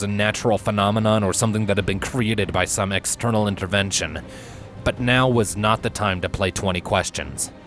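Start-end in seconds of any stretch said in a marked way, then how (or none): none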